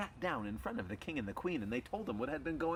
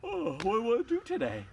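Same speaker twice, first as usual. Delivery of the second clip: high, loud waking dog voice; 10 semitones higher, 6 dB louder than usual. Acted. Dogs waking the next day, waking voice. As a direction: wake up high and eager as a dog.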